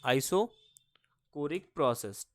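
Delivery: Isochoric process stretched out slowly and softly; very slow, drawn out. Shown as a demonstration. Teacher slowly reading the heading to students. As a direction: drag out "isochoric process" as if writing it on the board. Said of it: "तो अब isochoric process को".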